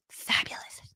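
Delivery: soft, voiceless "fabulous"; whispered admiration. Acted. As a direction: breathe it out in showy admiration. Showing off the improved photo.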